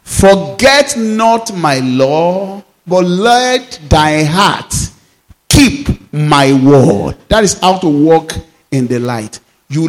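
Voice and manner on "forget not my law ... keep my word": slow, weighty recitation of the verse; slow solemn reading over music; solemn scripture recital. Acted. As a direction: intone it slowly like holy writ.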